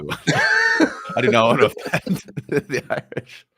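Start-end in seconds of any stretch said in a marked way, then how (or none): 0.2-1.1 sudden loud laugh; loud burst of laughter. Real, not acted. Big laugh at Irish joke.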